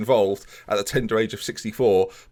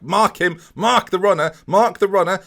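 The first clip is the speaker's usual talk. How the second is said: louder, higher Mark the runner; urgent shouting; 7 semitones higher, 5 dB louder than usual. Real, not acted. Gamer yells at his defenders to mark, loud and high. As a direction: bark orders at defence.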